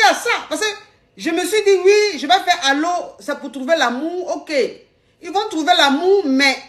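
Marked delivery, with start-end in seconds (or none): none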